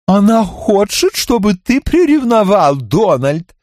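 high slow giggly taunt; giggling tease. A narrator's reading. Tipsy Zorka teasing Donald, high giggly taunt. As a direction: tease him through a giggle. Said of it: "хихикнула Зорка".

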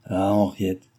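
usual speaking voice

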